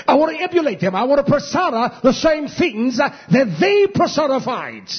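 high, loud preaching climax; fiery preaching. Acted. Pastor preaching to the congregation, fiery and loud.

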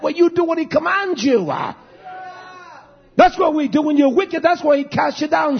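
high, shouted preaching; shouted rebuke from pulpit. Acted. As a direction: hammer it home to the congregation.

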